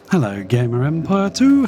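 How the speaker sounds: ordinary delivery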